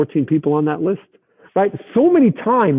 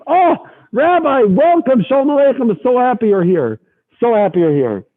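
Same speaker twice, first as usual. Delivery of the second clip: much higher fawning welcome; gushing host; 9 semitones higher than usual. Retold or imitated. Host greeting rabbi effusively.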